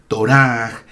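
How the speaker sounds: toRAH, Torah with a long stressed last syllable; final-syllable stress. Shown as a demonstration. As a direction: draw out the "rah" of Torah slowly. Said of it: "el acento recae siempre en la última sílaba".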